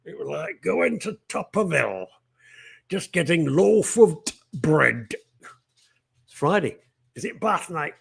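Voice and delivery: put-on broad Yorkshire voice; Yorkshire accent. Host spoofs bread advert about Yorkshire hills, Yorkshire accent. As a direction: do a mock Yorkshire accent, dropping the articles. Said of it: "Philip Harling in Yorkshire".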